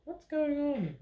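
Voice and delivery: high, wondering voice; high puzzled villager. Acted. Another villager asking what is happening, high puzzled voice. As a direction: ask it like a baffled bystander.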